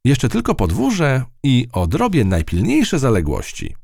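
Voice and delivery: high, satisfied mouse voice; proud of a quick job; high self-satisfied mouse; pleased with herself. A narrator's reading. Matylda to herself.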